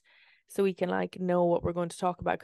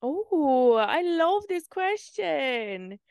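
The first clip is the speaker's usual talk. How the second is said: high, slow gushing delight; mock excited; 8 semitones higher, 4 dB louder, much slower than usual. Hosts hamming up a listener question, gushing. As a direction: coo over the question like a fan.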